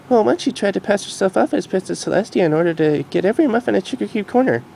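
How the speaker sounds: Future Derpy voice. Acted. Future Derpy telling the Doctor, in a high mare voice. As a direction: voice grown-up Derpy gently.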